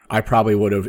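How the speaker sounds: normal talking voice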